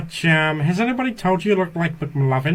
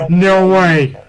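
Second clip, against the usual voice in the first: loud, thrilled disbelief; over-the-moon fan outburst; excited shout; 9 dB louder than usual. Real, not acted. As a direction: yell it out, can't believe it. Excited reaction to Metallica request.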